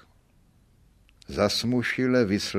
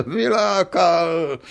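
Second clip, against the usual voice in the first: laughing, higher, louder, slow indignant cry; passionate outcry; 5 semitones higher, 4 dB louder, slower than usual. A narrator's reading. Accused man indignantly repeats the court's word, scornful laughing cry. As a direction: scoff then fling the word back. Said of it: "vzkřikl obžalovaný vášnivě".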